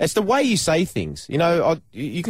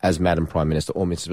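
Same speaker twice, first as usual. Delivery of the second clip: mock Prime Minister; 10 semitones lower than usual. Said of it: "I'll be the Prime Minister".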